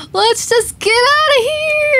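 slow, stretched high yell; panicked scream. Real, not acted. Player desperate to flee the game world, panicked screaming. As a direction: scream it high and loud, drawn out. Fleeing into the Nether.

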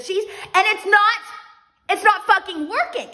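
high, worked-up comic exasperation; exasperated rant. Acted. Vlogger fighting with crumbling cheese, high exasperated rant. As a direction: rant as the frustration boils over.

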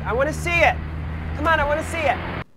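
normal talking voice

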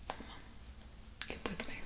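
hushed, urgent whisper; whispered plea. Acted. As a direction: beg him softly to hold on. Pleading with fading crewmate.

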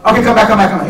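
high, urgent haggler's call; acted market seller calling after a buyer; calling a customer back.

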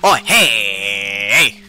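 very loud, drawn-out hey; startled shout. Pretend. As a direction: bellow at a surprise intruder. Startled by someone in bathroom.

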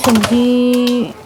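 "ਸਬਜ਼ੀ" stretched very slowly, with a falling tone; drawn out. Acted. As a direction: linger on "ਸਬਜ਼ੀ" as if thinking. Drawn-out word while searching fridge.

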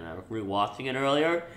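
ordinary delivery

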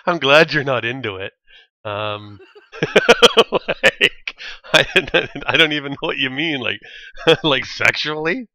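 talking through bursts of laughter; laughing. Real, not acted. Gamer teases friend about not being into it, laughing. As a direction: crack up between the words.

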